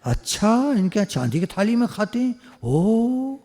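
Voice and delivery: mock-impressed envious visitor; envious guest. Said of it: "गालियाँ दे के जाएगा अंदर अंदर".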